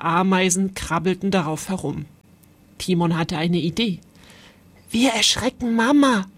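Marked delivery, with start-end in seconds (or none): none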